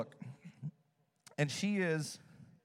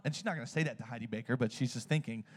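ordinary talk

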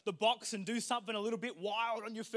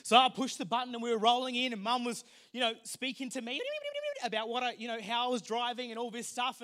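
normal talking voice

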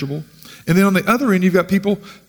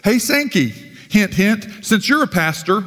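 higher-pitched mock call to a pastor; put-on higher voice of an acquaintance; imitated acquaintance; 6 semitones higher than usual. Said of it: "Person just says to me".

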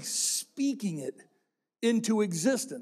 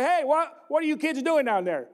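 higher mom calling down the stairs, lightly done; mock mom shout; 5 semitones higher than usual. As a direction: call down to the basement like mom. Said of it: "my mom would shout down".

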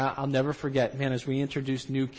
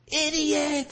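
high-pitched mock yell, held back in volume; imitated fading yell; 13 semitones higher than usual. Villagers yelling as Eve takes a bite, imitated dwindling high yell. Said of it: "People are yelling, volume starting to go down".

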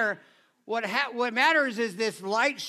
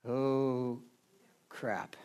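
low, much softer "oh crap"; dismayed mutter; 10 semitones lower, 6 dB quieter than usual. Retold or imitated. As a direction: mutter it as the trouble sinks in. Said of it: "moments you're like".

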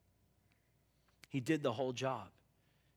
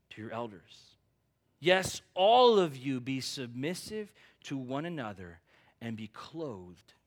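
scripture reading; 4 semitones lower than usual. Acted.

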